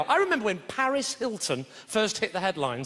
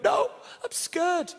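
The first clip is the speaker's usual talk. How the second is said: lightly voiced tearful little boy, higher and drawn out; crying child; 8 semitones higher, much slower than usual. Five-year-old tells dad why he's crying, tearful child voice. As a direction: stretch it like a sobbing small kid.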